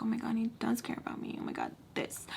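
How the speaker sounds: breathy, rushed whisper; hushed, touched realization to oneself; awed. Retold or imitated. Girl believing he does care about her, awed whisper. Retells moved inner thought.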